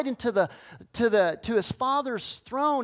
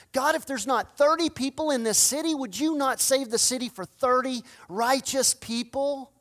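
higher, insistent bargaining plea; Abraham pleading with God; 5 semitones higher, 4 dB louder than usual. Acted. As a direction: press God again and again for the city.

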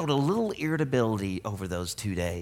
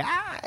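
put-on kid squeal. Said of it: "they run away".

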